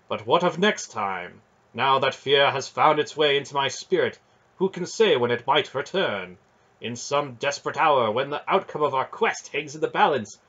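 a noble knight ashamed of his fear; grave knight lament. Acted.

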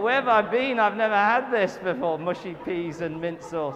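loud, high mock amazement; hammed-up disbelief at his own deprivation; mock astonishment. Preacher marvelling at mushy peas, loud and high. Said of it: "I thought".

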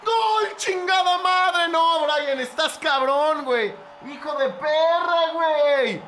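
long, high, loud goal cry; screaming a goal. Real, not acted. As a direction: bellow the goal, drawing out the words in disbelief. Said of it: "estoy gritando un gol así". (grito de gol desaforado)

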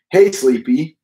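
a cheery wake-up call to the bedroom. A narrator's reading. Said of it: "Missus Midas called".

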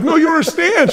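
high, loud, laughing protest; excited protest. Retold or imitated. Young Tony pleading with the bank lady, high excited protest. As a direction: plead with her, laughing at yourself.

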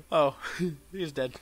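usual speaking voice

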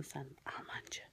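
hushed whispered taunt; whispering. Acted. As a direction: whisper the word like a jab. Whispered insult. (fısıltıyla)

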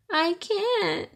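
lightly voiced grieving mother; mother telling her son why she can't cook barbecue; mother's voice. A narrator's reading. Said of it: "Jack's mother told him".

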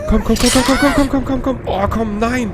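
rapid high-pitched panicky repetition; frantic urging. Real, not acted. Player fleeing from the killer, frantic high urging. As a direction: urge yourself on in a panic.

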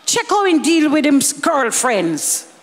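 ordinary talk, nothing marked

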